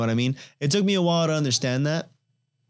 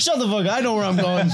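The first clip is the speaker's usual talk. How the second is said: mock driver snapping; 7 semitones higher than usual. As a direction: play the stubborn driver telling the GPS off. Mocks friend yelling at GPS.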